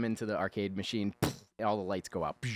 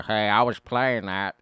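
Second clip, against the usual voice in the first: whiny complaint, louder; 7 dB louder, slower than usual. Retold or imitated. Voicing Giovanni Ribisi's character complaining.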